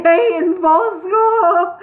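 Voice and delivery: put-on high, slow chuckling drunk call; a drunken brewer calling out between chuckles. Drunk brewer to the dwarfs, high slow chuckling call.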